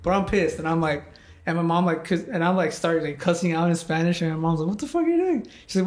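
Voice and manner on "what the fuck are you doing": high mock mom yell, much higher. Mom bursting in on her furious son, high yell. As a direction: squeal it like a shocked mom.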